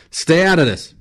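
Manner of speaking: high, loud warning off; heated snap; angry rebuff. Acted. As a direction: shout him out of the argument. Travis rebuffs Lesperance in quarrel.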